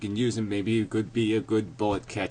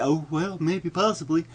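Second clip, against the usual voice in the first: meek; 5 semitones higher than usual. Pretend. Mocking those who only think it, meek. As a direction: drop the rant for a timid little waver. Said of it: "just do it really meekly".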